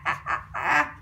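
ordinary delivery

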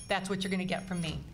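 ordinary delivery

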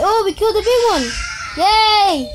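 high, loud victory shout; excited cheer. Real, not acted. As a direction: yell it out like you just won. Victory cheer while brothers interrupt.

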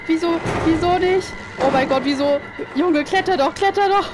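loud, high desperate urging; exasperated shouting at the game; frantic yelling. Real, not acted. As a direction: yell at the character to climb. Character won't climb, player panics.

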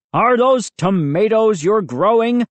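high, taunting comeback; retort. A narrator's reading. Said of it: "Moose's dad shot back".

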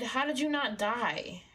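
usual speaking voice

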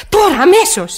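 urgent demand. Acted. Demanding police car now.

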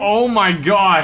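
slow, loud and high; loud, stretched-out astonishment; shocked exclamation. Real, not acted. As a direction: draw it out in disbelief. Reacting to hard crash.

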